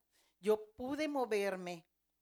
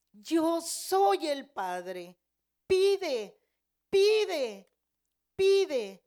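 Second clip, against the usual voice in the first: loud, raised, commanding proclamation; God the Father; 7 semitones higher, 13 dB louder, much slower than usual. Retold or imitated. Great elder in the clouds addressing her, booming command. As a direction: boom each command out, with space between.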